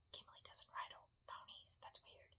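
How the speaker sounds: soft unvoiced whisper to herself; whispered aside. Real, not acted. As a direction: breathe it as a private aside.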